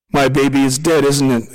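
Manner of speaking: fearing the worst, half-sobbing; sobbed. A narrator's reading. Young mother asks the leader about her baby, sobbing. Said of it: "the young woman sobbed".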